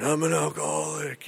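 soft, lonely mumble; mumbling to himself. Retold or imitated. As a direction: murmur it like nobody's listening. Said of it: "mumbling to no one".

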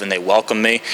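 normal talking voice

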